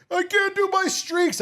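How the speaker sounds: put-on high, slow whine of a kid; whiny kid. Son, losing his phone: high whiny complaint.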